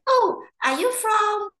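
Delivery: high, puzzled questioning voice; a curious local asking where she's from, puzzled and high; puzzled foreigners. Retold or imitated. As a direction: mimic curious foreigners unsure of the accent. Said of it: "and the people will say".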